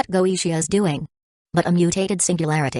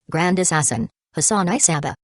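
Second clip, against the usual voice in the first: ordinary delivery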